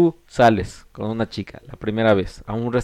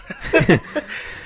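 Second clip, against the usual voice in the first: high breathy laugh; 12 semitones higher, 4 dB quieter than usual. Real, not acted. Laugh after his own joke.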